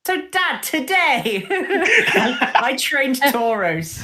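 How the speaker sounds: high, bubbly letter to dad with laughter; reading a letter. Retold or imitated. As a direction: gush it like a proud daughter's letter. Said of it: "write him to one of her letters to her dad".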